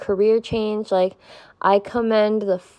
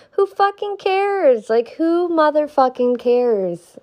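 emphatic dismissive; 7 semitones higher, slower than usual. Real, not acted.

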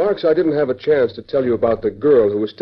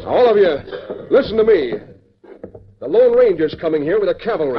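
urgent rally; 7 semitones higher than usual. Acted. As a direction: rouse the men, it's an emergency. Boss rallying his men urgently.

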